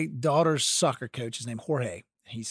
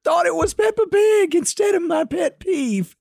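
laughing shout, much higher and slow; excited shout; 5 dB louder, slower than usual. Real, not acted. Host yelling the punchline to her cohost, excited. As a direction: shout it out, delighted by the mix-up.

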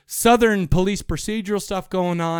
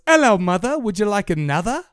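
higher, lilting mock British, rising at the end; British accent; 4 semitones higher, 4 dB louder than usual. Host retelling mover's pickup line, mock British. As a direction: imitate a cheeky British charmer. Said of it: "Do you know what else he would say?".

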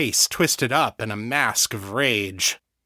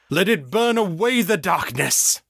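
high ringing preacher call; preaching; 8 semitones higher than usual. A narrator's reading. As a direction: proclaim it over the room. John preaching at exorcism.

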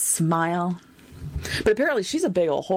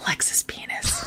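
soft secret whisper; 5 dB quieter, slower than usual. Pretend. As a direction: whisper it teasingly. Whispered call and response.

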